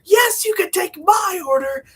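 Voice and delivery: very high, loud frantic yell; desperate shout. Retold or imitated. Courtney finally reaching civilization, frantic high shout. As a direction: scream it like you've been lost in the wild. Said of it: "And she's like".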